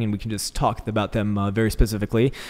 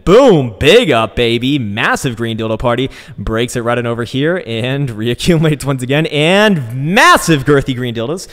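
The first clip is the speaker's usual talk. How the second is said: loud, hyped-up market cheer; excited shout; 3 semitones higher, 8 dB louder, slower than usual. Real, not acted. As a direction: shout it like a big win. Hyping a big price pump.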